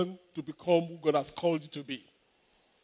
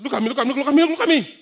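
look at me; 4 semitones higher, 5 dB louder, much faster than usual. Retold or imitated. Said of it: "I'm like, I said".

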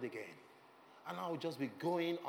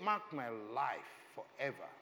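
ordinary talk, nothing marked

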